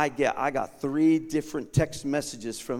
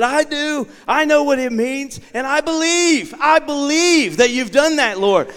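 high, loud, ringing cry of faith; rising fervent declaration; 10 semitones higher, 9 dB louder than usual. Retold or imitated. Preacher reliving his cry of faith to God. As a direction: lift it up like a shout of praise.

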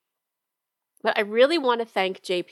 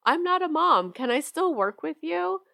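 asking client; 8 semitones higher than usual. Retold or imitated. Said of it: "I get asked all the time".

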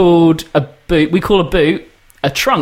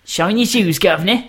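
high, cheeky mock Cockney; Cockney accent; 5 semitones higher than usual. Shown as a demonstration. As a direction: put on a stock Cockney accent. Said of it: "a little bit of Cockney".

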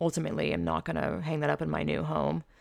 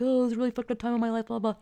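quick, high mock excuse; dismissive mimicry; 6 semitones higher, much faster than usual. Storyteller mimics her friend's excuse, dismissive mimicry. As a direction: rattle it off higher and dismissively.

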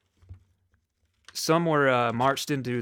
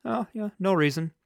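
higher, mocking sarcastic tone; 9 semitones higher, 3 dB louder than usual. Real, not acted. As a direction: answer it with mock cheer. Sarcastic answer to own question.

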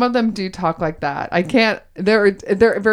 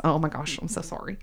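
ordinary talk

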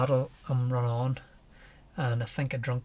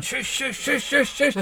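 hushing a dog; 11 semitones higher, 4 dB louder, slower than usual. Retold or imitated. Hushing a charging Rottweiler.

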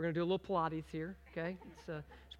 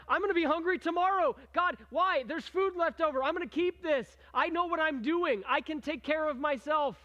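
higher, louder, defiant grumbling; self-reliant Israelite; 8 semitones higher, 7 dB louder than usual. Retold or imitated. Israelite hoarding leftover manna against orders, defiant and raised. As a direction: play the stubborn hoarder, pushing back. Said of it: "It's just because".

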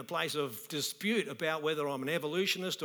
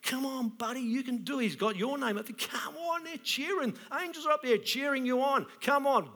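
high, excited barracking from the stands; cheering God; 8 semitones higher than usual. Retold or imitated. God in heaven barracking for the listener, high excited cheering. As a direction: cheer them on like a coach on the sideline. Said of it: "He's up there barracking for me".

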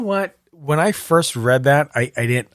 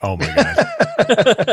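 laughing; 10 semitones higher, much slower than usual. Real, not acted. Laughing reaction to the story.

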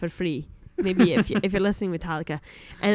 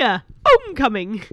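loud mock Paisley accent; Liam Farley; 2 semitones higher, 7 dB louder than usual. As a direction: do a hearty Paisley-accent impression. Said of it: "that was definitely Liam Farley".

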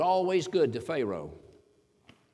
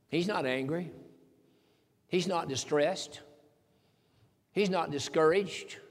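ordinary talk

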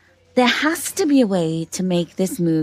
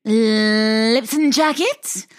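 slow announcer drawl; 3 semitones higher, much slower than usual. Acted. Announcing contestant.

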